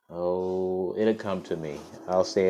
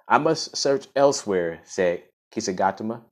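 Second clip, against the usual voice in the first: clear, fuller storytelling voice; story narration; 3 semitones higher, 6 dB louder than usual. Acted. Host reads the story to listeners, in a storybook narration. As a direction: read it out like a storybook.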